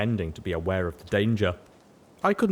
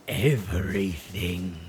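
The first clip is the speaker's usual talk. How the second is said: slow, creepily eager; chilling eagerness; slower than usual. A narrator's reading. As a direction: savor the word, eerily keen. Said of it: "she said with chilling eagerness".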